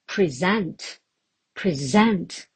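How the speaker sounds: "Present" as the verb, pri-ZENT; stress on second syllable. Shown as a demonstration. Teacher showing learners the verb, "Present" stressed on the second syllable. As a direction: stress the end of "Present", reduced first vowel. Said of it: "changing of the stress pattern".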